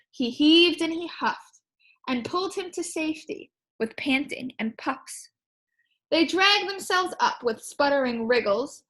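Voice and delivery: put-on lively read-aloud to children; story reading. Teacher reads rhyming book to her class, lively. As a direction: bounce the rhyme for a class.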